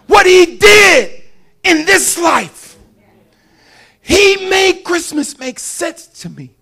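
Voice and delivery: high, loud, drawn-out sermon shout; full-force preaching. Real, not acted. Preacher testifying what God did in his life, full-force preaching. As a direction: proclaim it hard, stretching the key phrases.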